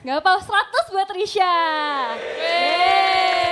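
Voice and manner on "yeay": long shouted cheer. Real, not acted. Host celebrates a contestant's perfect score, cheering. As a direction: whoop it out, held long.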